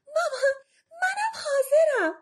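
high, drawn-out eager call to mother; excited announcement. A narrator's reading. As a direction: call out delightedly that you are ready. Cinderella announces she is ready.

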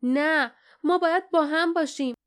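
high, insistent cry; protesting shout. A narrator's reading. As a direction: shout your refusal to be separated. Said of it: "فریاد زد".

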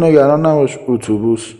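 loud, high shouted reply; calling back. Acted. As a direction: holler back reassurance while leaving. Calls back while hurrying away.

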